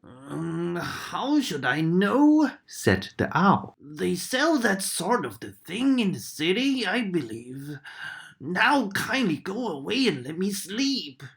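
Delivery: lightly voiced drowsy owl. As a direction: shoo her off like a sleepy owl. Owl answers grumpily.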